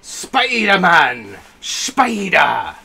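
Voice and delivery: loud, drawn-out high cheer; stretched, excited yell; fan shout. Real, not acted. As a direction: holler it like a hero's fanfare. Cheers Spider-Man on screen.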